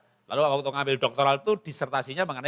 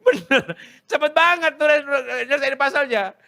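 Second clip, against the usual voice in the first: very high, loud astonishment; amazed exclamation; 12 semitones higher, 7 dB louder than usual. Acted. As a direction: marvel in a raised, high voice. Acted amazement at finished puzzle. (kagum heboh, suara melengking tinggi)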